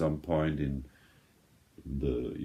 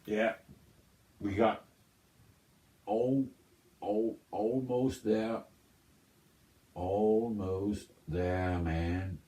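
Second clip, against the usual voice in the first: slow, stretched stoner hippie drawl; dreamy laid-back hippie stoner voice; 4 semitones higher, slower than usual. Acted. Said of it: "sound like a stoner, like a hippie man".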